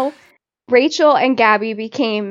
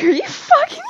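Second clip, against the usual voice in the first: much higher, shocked mock disbelief; incredulous outburst. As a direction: throw it out like you can't believe your ears. Friends' outraged reaction retold.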